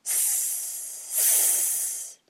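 long soft unvoiced snake hiss. Snake appears hissing. (蛇的嘶嘶声)

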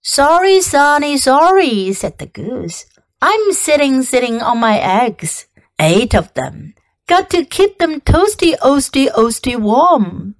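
a busy mother goose, bustling and quick to refuse; fussy goose. Acted. Goose character with repeating words.

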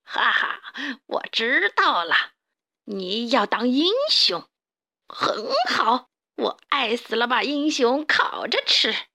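slow, swooping villain drawl; gloating cartoon villain; sinister mole boss. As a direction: savour the threat with a mocking laugh. Villain boss taunting the hero.

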